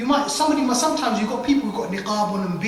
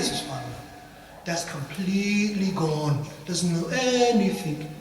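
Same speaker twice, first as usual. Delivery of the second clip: normal talking voice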